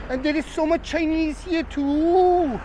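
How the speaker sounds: usual speaking voice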